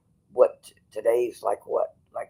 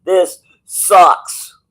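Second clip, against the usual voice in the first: high loud yell of frustration; loud frustrated yell; scream; 7 semitones higher, 11 dB louder than usual. Pretend. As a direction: let it rip, loud and high. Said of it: "you need to scream".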